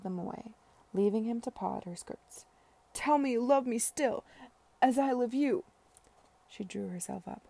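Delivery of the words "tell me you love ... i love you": high, loud, imploring; desperate pleading. A narrator's reading. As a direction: beg for love, voice raised. Drunken suitor begging at her feet.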